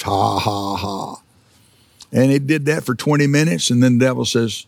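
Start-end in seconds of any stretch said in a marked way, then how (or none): 0.0-1.2 soft mock ha-ha laugh that fades away; chuckle, unbothered by the pain; retold defiant chuckle. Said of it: "and yet he laughed".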